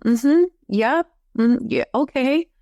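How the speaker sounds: unsure, drawn-out mm-hmm yeah; hesitant yes. Shown as a demonstration. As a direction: sound doubtful and half-hearted. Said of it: "that was an out loud congruent yes".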